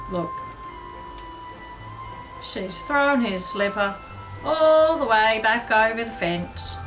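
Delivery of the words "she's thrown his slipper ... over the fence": ordinary delivery, nothing marked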